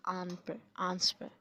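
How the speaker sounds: आंस said as the stressed first syllable; teacher picking out stressed आंस. Shown as a demonstration. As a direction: lean on आंस, the first part of answer. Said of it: "इसमें stress है".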